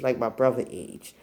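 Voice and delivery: usual speaking voice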